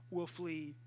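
ordinary talk, nothing marked

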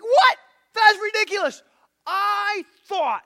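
a proud general exploding in disbelief; freaking out. Retold or imitated. Naaman hears the prophet's message and freaks out, outraged cry. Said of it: "And Naaman freaks out".

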